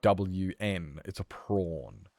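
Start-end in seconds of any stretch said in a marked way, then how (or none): 0.0-1.0 slow letter-by-letter spelling; spelling out slowly. Shown as a demonstration. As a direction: spell prawn one letter at a time. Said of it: "Like, P R A".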